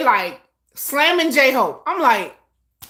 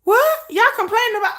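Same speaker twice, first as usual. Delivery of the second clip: jumps much higher on a startled What; disbelief, the What shooting up; incredulous what; 8 semitones higher than usual. Retold or imitated. Reacting to fans complaining, incredulous and startled. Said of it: "I was like".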